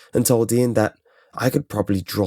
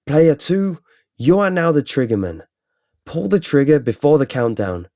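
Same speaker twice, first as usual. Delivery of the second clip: lightly voiced game prompt, higher; a hint of computer voice, pitched up; computer announcer; 5 semitones higher, 4 dB louder than usual. A narrator's reading. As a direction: announce the next turn like a recording. Computer voice announces next player.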